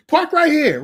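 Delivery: high, loud, thrilled welcome; bursting with excitement; excited greeting. Retold or imitated. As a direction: greet him like a long-lost friend. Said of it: "he is so excited".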